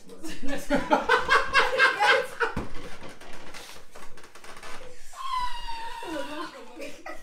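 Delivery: shrieking burst of laughter. Real, not acted. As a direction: howl with laughter.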